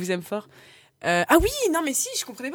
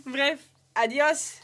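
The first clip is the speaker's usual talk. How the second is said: drawn-out mock farewell; slow goodbye; 4 semitones higher, 4 dB louder, much slower than usual. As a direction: stretch the goodbye out slowly.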